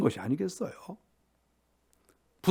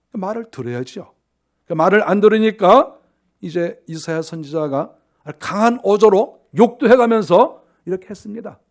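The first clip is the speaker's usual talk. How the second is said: ordinary delivery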